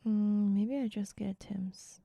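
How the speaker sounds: unvoiced whispered musing. Real, not acted. Streamer whispers to herself while deciding food, hushed. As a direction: whisper the thought to yourself. Thinking aloud about breakfast.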